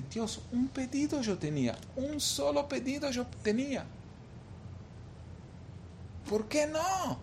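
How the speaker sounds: high-pitched, restrained lament; anguished plea. Retold or imitated. Bereaved father questioning God after losing his son, anguished plea.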